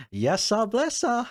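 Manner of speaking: high-pitched sing-out sign-off; playful catchphrase. Acted. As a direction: throw the voice way up for the catchphrase. Performs his sign-off catchphrase.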